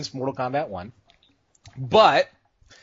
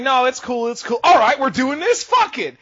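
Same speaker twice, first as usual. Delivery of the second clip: imitated brush-off building to a louder, higher challenge; player giving in to fight; 7 semitones higher, 5 dB louder than usual. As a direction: act out shrugging it off, then squaring up. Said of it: "he did turn around 'cause he's like".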